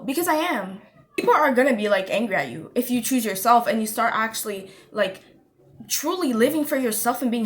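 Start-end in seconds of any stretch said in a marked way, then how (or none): none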